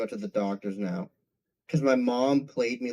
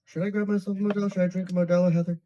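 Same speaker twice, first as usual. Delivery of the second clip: higher, faster, teasing singsong; playful high sing-song tease; 6 semitones higher, much faster than usual. Pretend. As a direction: dangle the idea to tempt her. Teasing rephrase of the question.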